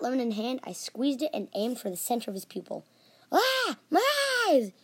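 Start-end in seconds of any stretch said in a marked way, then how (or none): 3.3-4.7 high, loud scream of pain. Acted. Brother squirted with lemon in the eye, screamed. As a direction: shriek it in short bursts. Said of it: "he screamed".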